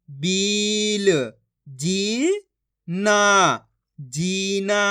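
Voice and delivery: each syllable stretched long and held; very slow syllable-by-syllable reading demo; drawn-out pronunciation. Shown as a demonstration. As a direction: draw out every vowel like a reading teacher. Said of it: "आपको खींच के पढ़ना है".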